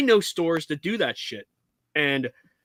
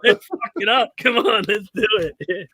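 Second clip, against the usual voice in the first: egging on; 6 semitones higher, 5 dB louder than usual. Real, not acted. Egging host to go negative.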